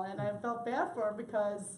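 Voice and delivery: usual speaking voice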